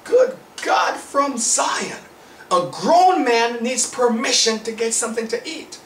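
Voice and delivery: high-pitched, drawn-out astonished exclamation; retold shock. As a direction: relive the disbelief, pitched well up. Recalls his shocked thought at coworker.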